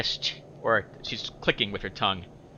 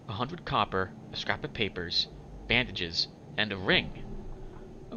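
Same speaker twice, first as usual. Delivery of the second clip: ordinary delivery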